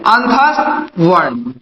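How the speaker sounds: drawn-out, slow "अंतस्थ वर्ण" with a falling end; slow recital answer. Shown as a demonstration. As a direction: spell out "अंतस्थ वर्ण" slowly for the class to repeat. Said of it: "क्या बोलते हैं?". (धीरे-धीरे खींचकर "अंतस्थ वर्ण" बोलना)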